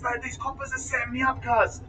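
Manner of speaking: high, agitated retold outburst without shouting; jumping-up-and-down excitement. Suspect retelling his outburst at the police setting him up, excited. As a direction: run on in high-pitched indignant triumph. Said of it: "I'm jumping up and down".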